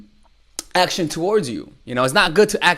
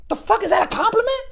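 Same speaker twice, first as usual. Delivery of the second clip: mock confused date. Mimicking the date's reaction.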